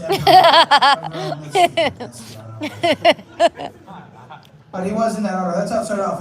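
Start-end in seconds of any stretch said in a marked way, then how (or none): 0.0-1.7 mock grand announcement. 2.0-3.7 hammed-up voice. Hammed up aside.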